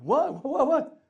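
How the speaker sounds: curious husband pressing his wife, growing eager; eager curiosity. Acted. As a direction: pester her to spill the news. Husband eager for wife's news.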